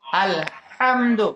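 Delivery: "আলহামদু" very slowly, with a breathy throat h; throat ha drill. Shown as a demonstration. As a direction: split "আলহামদু" after আল, then draw out হাম from mid-throat. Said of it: "এই হরফটা উচ্চারণ করলে". (টেনে টেনে, গলার মাঝখান থেকে হা দিয়ে "আলহামদু")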